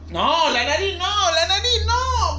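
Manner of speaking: high, drawn-out playful whine; giggly protest. Acted. Fending off the supervisor from the nose, high playful protest.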